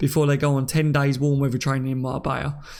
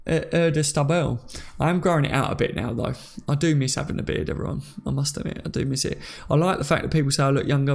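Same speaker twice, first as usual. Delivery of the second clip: usual speaking voice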